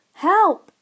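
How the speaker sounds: high, loud robotic yelp; mechanical cry for help. A narrator's reading. The computer in the backpack crying out, mechanical and high. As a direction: cry out like an alarmed machine. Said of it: "came the mechanical voice".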